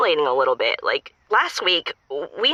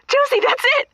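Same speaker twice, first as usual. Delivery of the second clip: much higher and louder, thrilled; overjoyed; 4 dB louder than usual. Real, not acted. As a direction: burst out with delight. Riddle solved, overjoyed caller.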